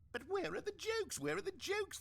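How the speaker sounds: shrill-voiced mock of fans bemoaning; mocking whine. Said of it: "they bemoaned".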